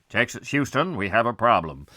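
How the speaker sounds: mock mission radio voice, dropping low at the end; astronaut radio call. Host playing astronaut to Houston, crackly radio impression. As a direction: imitate a spaceflight radio transmission.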